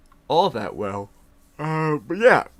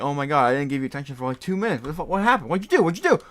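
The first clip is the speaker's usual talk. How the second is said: imitated freak-out; 3 semitones higher, 5 dB louder, much faster than usual. Coworker finds the cat motionless, mock freak-out. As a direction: build from fretting to frantic questioning. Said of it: "they start freaking out".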